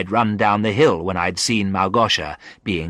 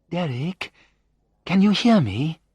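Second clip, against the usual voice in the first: high-pitched, questioning call; distant calling voice; 9 semitones higher than usual. A narrator's reading. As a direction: try to reach someone unconscious. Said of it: "I heard a voice say thousands of miles away".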